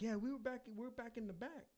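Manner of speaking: high-pitched imitation of a woman; lifted, high voice for a retold reply; older woman. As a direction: put on a high female voice, explaining. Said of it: "She's like".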